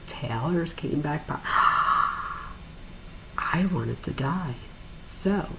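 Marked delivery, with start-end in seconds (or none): 1.5-3.3 gasp. Real, not acted. Tearful gasp mid-story.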